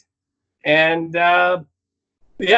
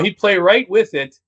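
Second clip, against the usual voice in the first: ordinary talk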